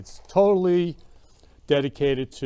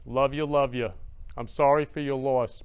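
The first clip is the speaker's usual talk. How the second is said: ordinary talk, nothing marked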